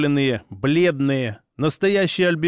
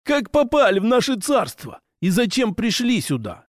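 orc voice; 7 semitones higher than usual. Acted. Orc leader questions intruders.

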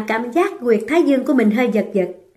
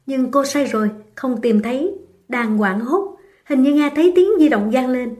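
usual speaking voice

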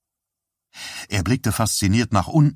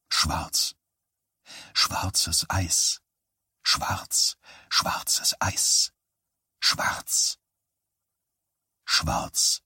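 dazed whisper; 4 semitones lower, 6 dB quieter, much slower than usual. Acted. Unconscious boy's echoing thought.

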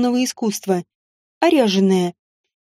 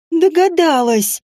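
spiteful snap; 4 semitones higher, slower than usual. Acted. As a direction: spit it out in bitter defeat. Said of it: "зло бросила совсем не Элла".

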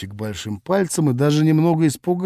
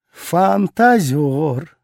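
drawn-out, scoffing and louder; eye-rolling; 5 semitones higher, 4 dB louder, much slower than usual. Acted. Pelmeshka scoffs at the flirt, slow and exasperated. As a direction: stretch it out with a dismissive scoff. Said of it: "закатила глаза Пельмешка".

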